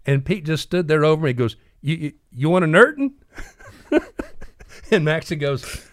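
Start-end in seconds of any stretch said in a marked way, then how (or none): 1.8-3.1 high, loud stuttering taunt; stammering. Retold or imitated. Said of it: "he stammered".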